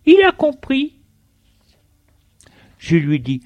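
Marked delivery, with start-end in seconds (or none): 0.1-1.0 high-pitched wife voice; high, questioning storybook wife; wife's question. A narrator's reading. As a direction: ask as the wife, well above your own pitch. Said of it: "La femme lui demande".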